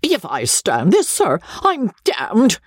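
high-pitched outrage without much volume; contained roar of a wounded gentleman; indignant mock roar. A narrator's reading. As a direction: protest hotly, dragging out the last words. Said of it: "roared Joseph".

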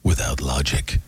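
low, slow trailer narrator; deep, drawn-out trailer voice-over; movie trailer voice. Shown as a demonstration. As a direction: intone it like an ominous film trailer. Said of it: "You wanna hear a trailer voice".

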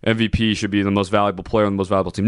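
fast, dismissive parroting; sneering imitation of the writers; mocking quote. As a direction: rattle off their tired argument. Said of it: "I'm sick of hearing this".

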